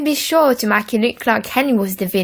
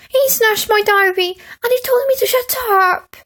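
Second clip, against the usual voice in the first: high, loud tearful wail; 13 semitones higher, 3 dB louder than usual. A narrator's reading. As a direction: cry it out to Mum. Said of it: "wailed Peter".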